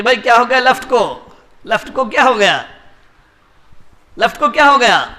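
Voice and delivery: لفٹ mispronounced as "لپٹ", deliberately mangled; لفٹ کو لپٹ کہنا. Retold or imitated. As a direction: say لفٹ as "لپٹ" on purpose, repeating the question. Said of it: "اس کا میں نے نام بگاڑ کر پکارا". (لفٹ کو جان بوجھ کر "لپٹ" بولنا)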